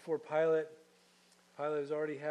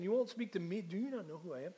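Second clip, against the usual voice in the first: higher-pitched offended disbelief; indignant; 4 semitones higher, faster than usual. Acted. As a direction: ruler insulted by silence, demanding. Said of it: "Can you hear the indignation?".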